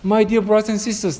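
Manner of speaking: ordinary talk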